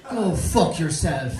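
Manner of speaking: slow, loud, punched-out insult; loud mock retort. Grumbler in line tells off the tourist, loud mock retort. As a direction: land it loud and drawn out.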